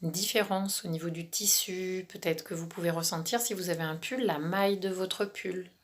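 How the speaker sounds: calm relaxation guide, half whispered; guided meditation. Acted.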